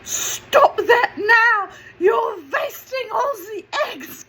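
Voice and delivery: high, loud, slow yell, laughing through it; mock yell. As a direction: shout the order at him in a put-on mum voice. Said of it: "she yells out".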